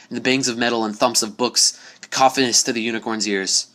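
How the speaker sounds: usual speaking voice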